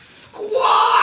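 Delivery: high, loud screeching parrot squawk. Acted. Game master keeps interrupting with a shrill parrot squawk. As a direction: shriek it like a cranky parrot.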